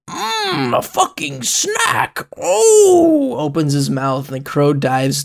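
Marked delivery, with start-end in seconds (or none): 0.1-3.4 high, slow, hungry relish; greedy monster child. Acted. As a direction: savor it like a creepy kid spotting a treat. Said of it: "and the Binky goes".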